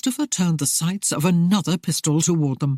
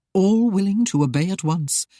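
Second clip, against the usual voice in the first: ordinary delivery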